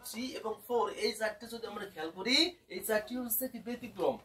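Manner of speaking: ordinary delivery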